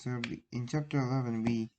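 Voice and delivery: plain reading voice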